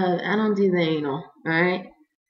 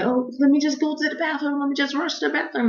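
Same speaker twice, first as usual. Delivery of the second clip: high, hurried plea; replayed panicky urgency; acted urgent pleading; 9 semitones higher, faster than usual. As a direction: beg to dash off, high-pitched. Urgently begging to reach the bathroom.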